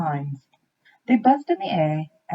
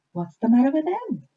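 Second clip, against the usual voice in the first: curious deer; 8 semitones higher, 3 dB louder than usual. A narrator's reading. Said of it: "asked a deer".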